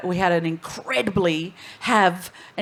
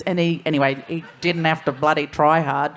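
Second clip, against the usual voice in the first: normal talking voice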